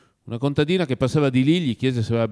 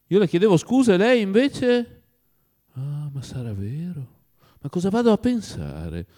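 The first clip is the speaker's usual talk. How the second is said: excited disbelief; 8 semitones higher, 3 dB louder than usual. Acted. Oblomov's joyful disbelief.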